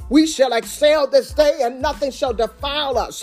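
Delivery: usual speaking voice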